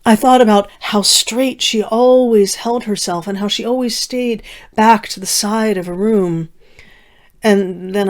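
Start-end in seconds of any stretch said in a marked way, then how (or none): none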